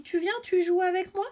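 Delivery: aigu, invitation enjouée d'un enfant; petit garçon qui propose. Acted. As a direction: propose de jouer comme un gamin. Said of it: "il propose à son copain et lui dit". (garçon invitant son copain, voix aiguë)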